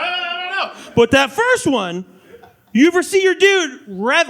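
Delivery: normal talking voice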